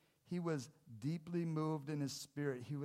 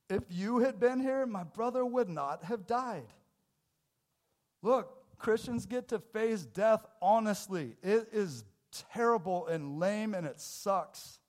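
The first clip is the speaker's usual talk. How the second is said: louder and higher, pressing each word home; fervent preaching; 7 semitones higher, 9 dB louder than usual. Acted. Pastor pressing the church to face death, loud and fervent. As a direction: preach it hard at the congregation.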